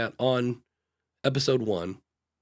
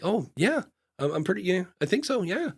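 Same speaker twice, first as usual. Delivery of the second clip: higher, unsure, trailing yeahs; hesitant reply; 7 semitones higher than usual. Retold or imitated. Imitating a podcaster unsure the episode helps. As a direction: sound doubtful about your own answer. Unsure client answering back.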